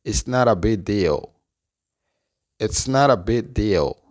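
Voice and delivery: "not a" joined as "nara" in "It's not a big deal"; linked pronunciation. Shown as a demonstration. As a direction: say "not a big" as "nara bi", t tapped and g softened away. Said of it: "la oración completa se pronuncia". ("not a" unido, como "nara")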